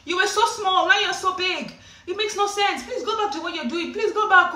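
high, loud aunties nagging, fading; screaming aunties. Retold or imitated. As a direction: mimic the aunties shrieking then tutting. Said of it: "They would say".

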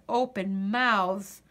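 usual speaking voice

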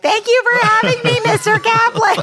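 put-on very high, loud singsong kid voice; polite kid in the doorway. Kid in the doorway calling thanks to friend's dad, hammed-up and high. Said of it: "my dumb body standing in the doorway".

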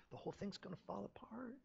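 high anxious whisper; worried whisper. Retold or imitated. Pastor at home fearing the church will collapse, whispering. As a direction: fret under the breath. Pastor voicing his worry.

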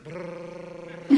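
soft, low imitated motor whirr; lip-buzz like air blowing steadily; machine noise. Comic sleeps like an angel on his machine, whirring.

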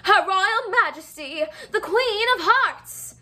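high, loud, slow herald call; royal announcement. Acted. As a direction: announce her like a court herald. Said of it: "blew his horn and announced".

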